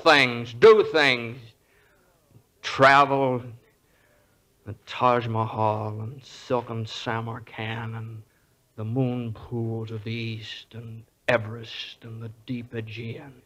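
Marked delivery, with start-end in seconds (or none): 4.7-13.3 much softer and slower, drifting off into a dream; wistful fading. Acted. George tells the angel his lost dreams, softer and slower until it fades. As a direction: let the faraway places fade away to nothing.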